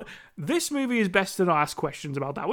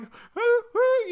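hooting; 14 semitones higher than usual. Retold or imitated. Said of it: "and it's like".

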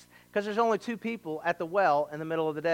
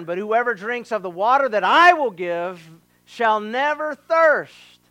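louder, rising to a peak on thirst; emphatic proclamation; 4 semitones higher, 7 dB louder than usual. Acted. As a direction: preach it, build to thirst. Quoting Jesus emphatically.

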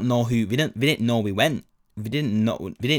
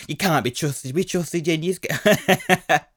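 higher-pitched, hammed-up teacher telling-off, slowing at the end; mock teacher rant; 6 semitones higher, slower than usual. Teacher rants at pupils who sneaked out, higher hammed-up scolding. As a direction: play the ranting teacher for laughs. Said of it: "I'm gonna go at us".

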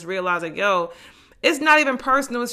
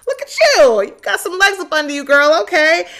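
high, loud hype at herself; hyped self-praise; 8 semitones higher, 8 dB louder than usual. Retold or imitated. To herself in the mirror, loud high excited self-hype. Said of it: "I looked at the mirror the other day and I was like".

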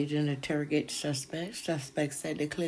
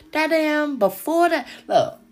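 loud, higher burst; excited outburst; 9 semitones higher, 10 dB louder than usual. Real, not acted. Host erupting to viewers about the crime, loud. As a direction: blurt it out loud.

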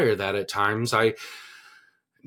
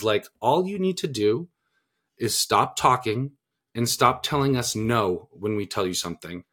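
ordinary delivery

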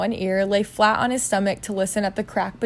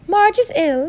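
high-pitched, louder voice for the aunt; mistaken character voice; 10 semitones higher, 5 dB louder than usual. Acted. Narrator gives the uncle's line in the aunt's voice, high. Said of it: "that's not Aunt Petunia".

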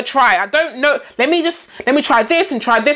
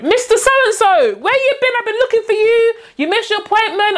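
much higher, chiding carer's voice; imitating a key worker; 9 semitones higher than usual. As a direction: call out to him like a fussing carer.

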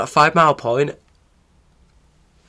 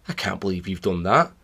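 ordinary delivery

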